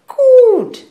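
high, very loud exclamation; emphatic approval. Acted. Mother seizing on daughter's church attendance, loudly emphatic.

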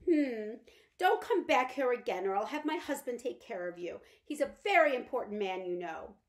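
a haughty lady warning off an intruder; threatening mother. Acted.

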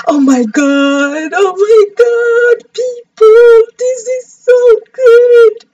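very high, loud squeal of joy; so excited. Real, not acted. Streamer seeing a surprise jack-o'-lantern, ecstatic squeal. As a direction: scream it in overjoyed disbelief. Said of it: "I'm so excited".

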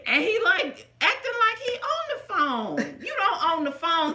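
high, loud, outraged; telling friends about the rude man, worked up and loud; indignant retelling. Retold or imitated. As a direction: pitch up and vent your disbelief. Indignant retelling, much higher.

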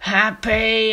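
loud outburst; sudden shout. Acted. Clown snapping after a whisper, shouting. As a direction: explode on the word.

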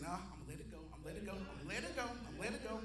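quiet, low, quick self-talk; talking himself down. Retold or imitated. Preacher recalls telling himself to drop it, talking himself down. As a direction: softly repeat it as if holding back.

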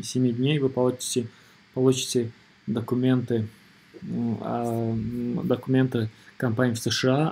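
normal talking voice

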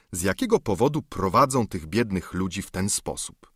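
usual speaking voice

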